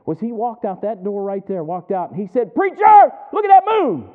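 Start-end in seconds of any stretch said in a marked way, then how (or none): 2.5-4.1 high, loud excited holler; excited shout. Retold or imitated. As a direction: call out in amazement at full voice. Retold excited calling out.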